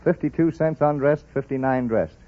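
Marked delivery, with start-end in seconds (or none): none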